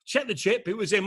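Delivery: ordinary talk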